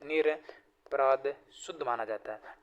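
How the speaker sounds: निरपराध stretched out, said slowly and softly; slow and deliberate. Shown as a demonstration. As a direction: spell out निरपराध slowly for the class. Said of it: "निरपराध होता है".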